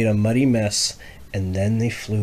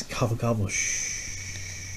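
soft gobble then whispered shh; hushed refrain; 10 dB quieter, slower than usual. Shown as a demonstration. Parent correcting the child, softly whispering the refrain. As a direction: hush the refrain. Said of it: "It says".

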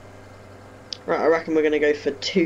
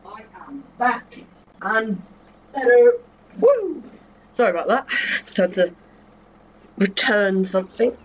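higher, puffed voice after a run; out of breath; 8 semitones higher, 4 dB louder than usual. Real, not acted. Gamer back from running downstairs to Dad, out of breath. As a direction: talk through real panting from the stairs. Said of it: "Don't know why I'm out of breath from that".

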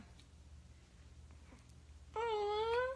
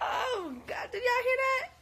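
high, loud, half-crying with laughter; laughing disbelief; 8 semitones higher, 6 dB louder than usual. Real, not acted. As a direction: share the shock through giggly tears. Baby makes a loud noise.

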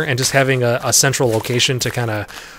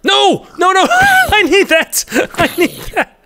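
high, laughing panicked squeal; panicked wail; 13 semitones higher, 3 dB louder than usual. Real, not acted. Player who threw the wrong thing, high panicked wail. Loses needed item in game.

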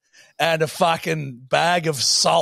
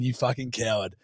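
inner voice; 5 semitones lower, 8 dB quieter than usual. Retold or imitated. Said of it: "in my head going".

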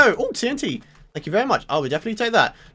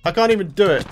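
usual speaking voice

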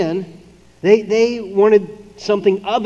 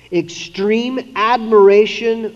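extreme admiration drawn out slow and louder; slow emphasis; 4 semitones higher, 4 dB louder, much slower than usual. Shown as a demonstration. Preacher defines idolatry, slow and stressed. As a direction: lean hard on extreme admiration. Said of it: "Idolatry is simply this".

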